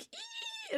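tiny high-pitched "ee" of delight; thin happy squeak; giddy squeal. Retold or imitated. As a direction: squeal softly, very high, imitating a giddy friend. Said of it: "she just feels so giddy".

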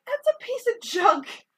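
high, scoffing put-down; dismissive Rey. Retold or imitated. Rey dismissing the ship they run toward, high scoffing voice. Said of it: "and Rey's like".

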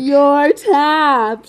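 loud, high mock outburst; roommate's exasperated holler; incredulous shout. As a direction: yell it in hammed-up disbelief. Roommate's outburst in retold story.

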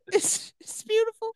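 very high, hammed-up final words; high, fading fake deathbed awe; mock dying voice. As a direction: fade out as if seeing heaven, for laughs. Play-acting dying after being stabbed.

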